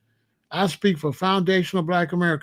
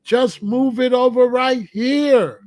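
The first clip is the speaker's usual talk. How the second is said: pleading; 7 semitones higher, 5 dB louder, slower than usual. Retold or imitated. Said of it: "they come and plead with the African".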